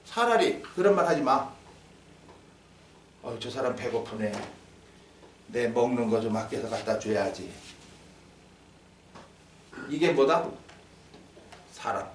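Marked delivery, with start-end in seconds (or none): 3.2-7.5 low, soft thinking aloud; quiet compassionate musing. Acted. Preacher voices someone noticing the hungry, quiet compassion. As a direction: murmur it low, as a kind private thought. Voices a kind private thought.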